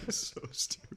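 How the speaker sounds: usual speaking voice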